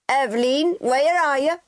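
high, loud call across a yard; mother calling her daughter across the farmyard. A narrator's reading. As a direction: call her from far away.

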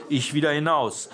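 ordinary delivery, nothing marked

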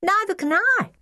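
put-on high-pitched child's protest; high child's voice calling out; child piping up. A narrator's reading. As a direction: chime in high and emphatic. Child echoes the loud complaint.

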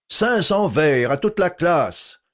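colère plus haute, lente et martelée; voix furieuse. A narrator's reading. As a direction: punit toute la classe avec fureur. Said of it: "d'une voix furieuse". (le professeur punit la classe, furieux et lent)

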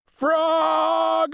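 high, drawn-out holler; coach's yell. A narrator's reading. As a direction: yell the name high and long. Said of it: "yelled the coach".

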